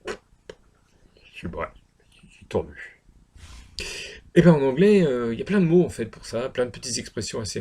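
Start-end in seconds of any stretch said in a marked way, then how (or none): 0.1-3.0 put-on low, slow drunken mumble; acted drunkenness. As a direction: play drunk, sinking low and soft. Acting out being drunk.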